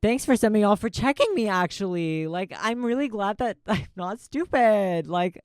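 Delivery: loud grateful outburst with a laugh; excited laughing thanks. Real, not acted. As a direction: gush your thanks, laughing at yourself. Thanking viewers who checked her.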